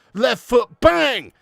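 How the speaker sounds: punchy loud call again; excited. Real, not acted. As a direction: shout the strike out. Repeating strike call.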